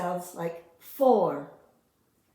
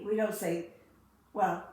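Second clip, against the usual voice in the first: usual speaking voice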